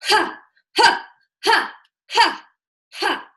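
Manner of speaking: high, loud, explosive "ha" bursts with gaps between; forceful "ha" thrusts. Shown as a demonstration. Teacher demonstrating the standing pelvic thrust, explosive staccato "ha". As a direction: fire out each "ha" high and hard. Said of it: "We're gonna do this".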